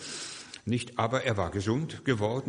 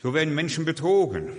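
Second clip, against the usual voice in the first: loud, higher indignant outcry; raised voice against the fraud; 6 semitones higher, 5 dB louder than usual. Real, not acted. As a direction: call out the deception with force. Preacher denounces faked miracles.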